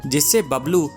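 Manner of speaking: plain reading voice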